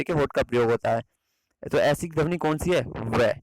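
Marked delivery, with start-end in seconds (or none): none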